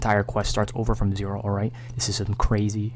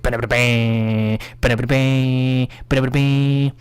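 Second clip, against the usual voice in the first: rattled syllables ending on a long held note; cartoon vehicle sound, drawn out; imitated car noise; 3 semitones higher, slower than usual. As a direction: chant out mock engine bursts.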